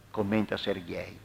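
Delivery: ordinary delivery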